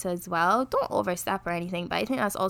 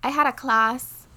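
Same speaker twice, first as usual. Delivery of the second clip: louder, drawn-out Bajan accent; strong Bajan accent; 5 semitones higher, 5 dB louder, slower than usual. Real, not acted. Telling co-host about class, strong Bajan accent slips out. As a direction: slip into broad Bajan, higher and slower. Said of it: "such a strong Bajan accent".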